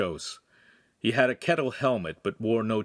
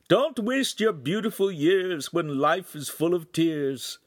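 put-on high, drawn-out old man's voice; slow, high-pitched old-timer giving advice; old wagon driver; 9 semitones higher, slower than usual. A narrator's reading. Old driver advises the young couple from his wagon, high and slow. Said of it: "The driver was an old man".

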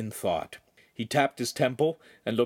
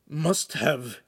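higher, laboured fragment; strained with pain; 5 semitones higher than usual. A narrator's reading. The doubled-over Podesta manages to say it, strained. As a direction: struggle to get the words out. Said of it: "he managed to say".

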